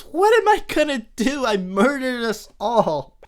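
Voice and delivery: high, loud, panicked wail of regret; despairing Homer. Retold or imitated. Homer alone in the woods, wailing that he doomed the family, loud and high. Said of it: "and, uh, he says".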